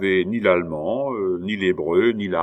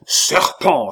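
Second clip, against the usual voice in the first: loud, higher angry cry; roar of anger; 4 semitones higher, 6 dB louder than usual. A narrator's reading. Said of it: "L'abbé poussa un rugissement de colère". (rugissement de colère)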